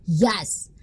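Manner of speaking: normal talking voice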